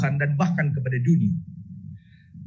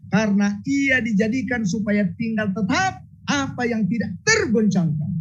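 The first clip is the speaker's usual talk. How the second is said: scripture reading; 6 semitones higher than usual. Acted.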